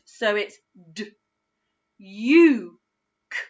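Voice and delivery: d, u, k said as separate sounds; sounding out. Shown as a demonstration. Teacher sounding out duke with the u sound. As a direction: segment d, u, k one at a time. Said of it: "it's the u sound".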